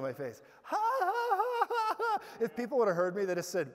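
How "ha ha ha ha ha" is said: high-pitched re-enacted hysterical cackle; hysterical laugh. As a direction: laugh madly at the pain. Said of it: "like, hysterically, with tears coming down".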